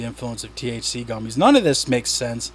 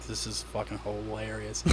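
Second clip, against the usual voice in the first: very quiet, low aside; amused aside; 5 semitones lower, 19 dB quieter than usual. Retold or imitated. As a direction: say it under your breath, lower and much softer. Recalls own private reaction, under breath.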